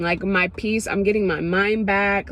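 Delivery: ordinary delivery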